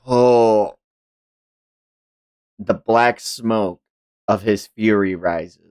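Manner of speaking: ordinary talk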